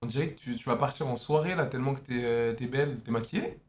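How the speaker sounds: low, soft flattering voice. Real, not acted. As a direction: coo the compliments gently. Compliments woman softly on camera.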